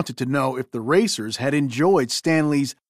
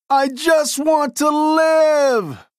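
loud, much higher, slow terrified shout; crying out; 11 semitones higher, 5 dB louder, much slower than usual. Acted. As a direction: wail it out in panic, stretching the words. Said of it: "cried Sandy".

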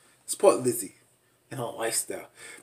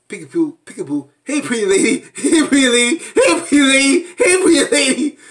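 high sing-song hey pretty lady, laughing; mock sing-song daddy; 11 semitones higher, 8 dB louder than usual. Vlogger mocking Michael greeting his daughter, high sing-song. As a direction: coo it like a creepy dad, high and loud. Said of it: "he's talking about".

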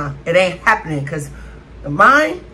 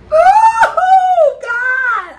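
high loud cry of disgust; shocked shout; 9 dB louder than usual. Real, not acted. Vlogger biting frog, a high shocked shout. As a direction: yell out as it hits.

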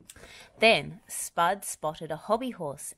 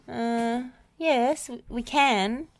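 plain reading voice

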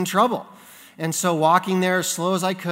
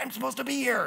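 soft, high stage whisper; hushed, high-pitched excuse; retold secret reply; 8 semitones higher, 7 dB quieter, faster than usual. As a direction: protest quietly so the teacher won't hear.

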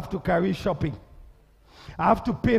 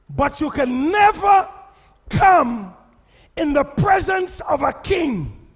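preaching; 7 semitones higher, 5 dB louder than usual. Acted. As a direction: proclaim it to the church. Preaching at full force.

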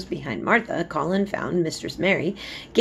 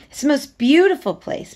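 higher, louder protest; 10 semitones higher, 9 dB louder than usual. A narrator's reading. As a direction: insist hard that it's beautiful. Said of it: "protested Mary".

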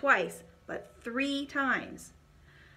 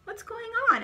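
alarmed question; 13 semitones higher, 4 dB louder than usual. A narrator's reading. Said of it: "asked Benjamin".

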